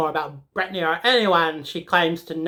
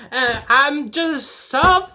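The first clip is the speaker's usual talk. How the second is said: frustrated; 6 semitones higher, much slower than usual. Real, not acted. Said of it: "so frustrated".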